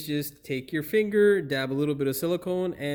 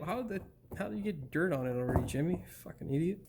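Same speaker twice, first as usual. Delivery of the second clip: muttered scolding; 8 dB quieter, faster than usual. Real, not acted. Muttering scolding at dirty gasket.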